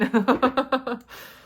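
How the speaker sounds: bright burst of real laughter; loud laugh. Real, not acted. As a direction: laugh out at your own bit. Laughs after Jack impression.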